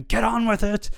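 high mock shout. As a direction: call it out like an impatient fan. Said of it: "fans out there shouting".

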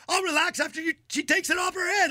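high-pitched mock fury; indignant retort. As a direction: fire back high as an outraged fan. Retells angry fan's retort.

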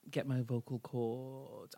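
ordinary talk

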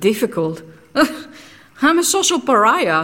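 half-laughing self-mockery; rueful laugh. Real, not acted. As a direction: laugh bitterly at your own fate. Bitter laugh at "difficult.

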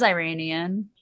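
"Iranian" opened with EYE, then RAY-nee-an; Southern pronunciation. Retold or imitated. Repeating her uncle's Southern "Iranian" for the hosts, eye-RAY-nee-an. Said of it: "so he says Iranian".

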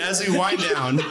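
ordinary talk

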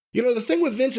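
normal talking voice

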